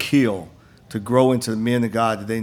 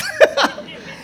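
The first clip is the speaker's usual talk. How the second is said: high laugh. Real, not acted. Laughing at his own story.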